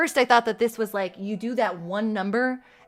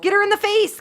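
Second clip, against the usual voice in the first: higher, louder shout "Get her in the face!"; excited cheering; 9 semitones higher, 6 dB louder, faster than usual. Real, not acted. Gamer cheering her character in a fight. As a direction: egg the fight on.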